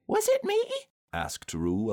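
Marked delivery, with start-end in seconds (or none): 0.1-0.6 put-on very high, squeaky small-child voice; a little surprised. A narrator's reading. Roo to Kanga, a little surprised. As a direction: ask in a high, loud little voice. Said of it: "a little surprised".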